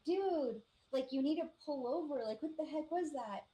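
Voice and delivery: mildly frantic demand to the driver; alarmed urging. Retold or imitated. Passenger urging the shaken driver to pull over, alarmed urging.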